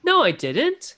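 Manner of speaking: high, swooping protest; indignant denial. Acted. Character denies an accusation of lying, indignant. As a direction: deny it with mock indignation. Acted quarrel, denying the accusation.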